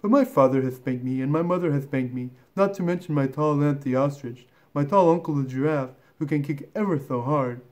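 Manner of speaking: earnest, rattling-on little elephant; young creature listing grievances politely; polite elephant child. Acted. Elephant child declining to come closer, earnest and polite. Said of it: "said the elephant's child most politely".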